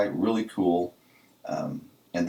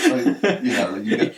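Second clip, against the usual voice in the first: laughing; 5 dB louder than usual. Real, not acted. Laughing after the joke.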